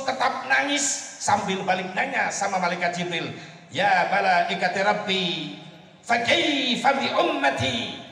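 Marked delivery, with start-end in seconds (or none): 3.7-8.0 slow Arabic plea. Acted. Prophet asks about his people.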